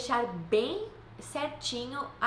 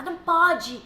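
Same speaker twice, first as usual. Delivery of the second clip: loud, high scolding shout; imitating a frustrated parent shouting; mock yelling at child; 5 semitones higher, 7 dB louder than usual. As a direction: yell "não pode" at a noisy kid in church. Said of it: "Adianta você gritar".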